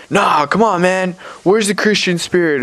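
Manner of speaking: put-on high, protesting drunk voice; imitated drunk pleading. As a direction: mimic a drunk's whiny protest. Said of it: "He's, like, drunk".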